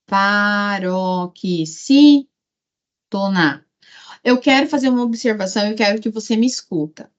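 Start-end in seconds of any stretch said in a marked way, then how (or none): 0.1-2.3 very slow, broken into syllables; slow dictation with a gap before the end. Shown as a demonstration. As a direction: sound it out piece by piece. Teacher spells out term by syllables.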